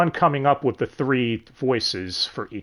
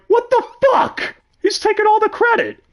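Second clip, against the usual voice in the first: high-pitched, louder indignant squawk; mock outrage; 5 dB louder than usual. Triplets see Claude take all the credit, outraged. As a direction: act out outrage in a much higher voice.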